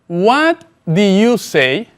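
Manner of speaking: each word spaced out slowly for the learner; slow model sentence. Shown as a demonstration. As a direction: say it slowly and clearly, like a teacher modelling. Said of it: "presta mucha atención". (pregunta modelo, lenta y clara)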